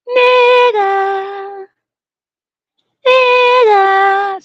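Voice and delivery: imitating a drawn-out holler; mock call-out. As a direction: call it across the street, mocking. Imitating people calling him out.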